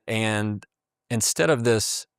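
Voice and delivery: usual speaking voice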